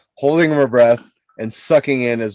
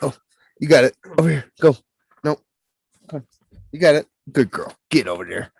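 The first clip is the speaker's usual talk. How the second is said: talking to dog. Real, not acted. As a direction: coax the dog along gently. Coaxing the dog.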